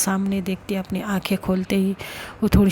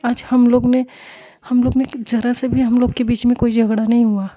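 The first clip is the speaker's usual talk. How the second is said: loud, insistent, higher-pitched defence; heated pleading; 3 semitones higher, 6 dB louder than usual. Acted. Samiksha to Payal, defending Mishka's behaviour, loud heated pleading. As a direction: press every point at full volume.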